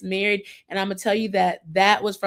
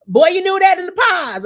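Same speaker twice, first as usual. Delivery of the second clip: loud, high-pitched burst of exasperation; mock scolding; 10 semitones higher, 8 dB louder than usual. Host scolding the boyfriend over his excuse, loud mock outrage. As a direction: call him out, raised voice and pitch.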